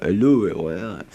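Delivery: low, soft faux French accent; joking, half-done French accent. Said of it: "faux French accents".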